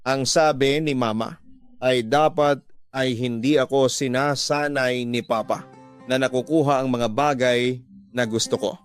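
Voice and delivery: usual speaking voice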